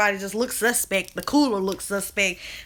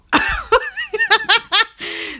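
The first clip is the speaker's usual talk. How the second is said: a high, loud burst of laughter while half choking; 3 dB louder than usual. Real, not acted. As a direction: let a high laugh burst out, fighting a cough. Laughing fit while choking on candy.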